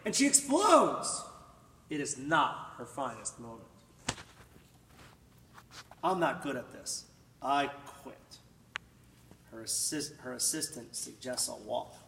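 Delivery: ordinary delivery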